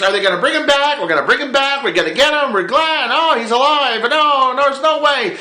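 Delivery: high, anxious back-and-forth; fretting fan voice. Retold or imitated. Fans fretting for weeks in a high anxious voice. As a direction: worry aloud like a nervous fan.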